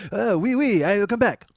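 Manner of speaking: hammed-up French brush-off; French accent. French guy twirling his mustache, mock French accent. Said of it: "He literally, he goes".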